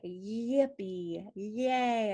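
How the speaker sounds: "yippee, yay" with each opening Y drawn out; slow teaching demo, lingering on the Y of "yippee" and "yay"; stretched Y. Shown as a demonstration. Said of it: "back of the tongue goes up".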